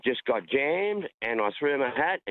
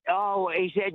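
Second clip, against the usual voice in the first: usual speaking voice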